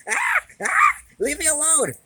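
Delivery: high, loud screech of leave me alone; shrieking. Acted. Cat shrieking at its owner to be left alone, high and loud. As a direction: shriek it at the top of the voice.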